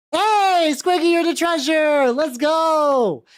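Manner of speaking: high, loud celebratory call; loud hype shout. Real, not acted. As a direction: hype up the new treasurer. Announcing new treasurer.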